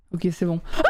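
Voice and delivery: usual speaking voice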